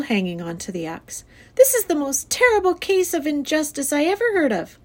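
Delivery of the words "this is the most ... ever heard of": high-pitched, outraged declaration; dramatic, pitched-up indignation; indignant girl. A narrator's reading. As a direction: protest it like an aggrieved child. Fern keeps arguing with father.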